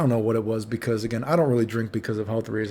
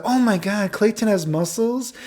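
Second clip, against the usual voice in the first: high, drawn-out gushing; imitated swooning girls; 9 semitones higher, slower than usual. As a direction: swoon in a put-on girly voice. Girls swoon over his muscles.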